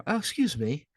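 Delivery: prim little "oh, excuse me"; polite kid voice. Retold or imitated. Kid greeting the narrator with put-on politeness. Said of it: "Being all, like, nice and shit".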